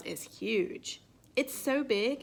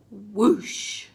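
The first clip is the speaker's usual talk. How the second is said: whoosh; 6 dB louder than usual. A narrator's reading. Water gushing out.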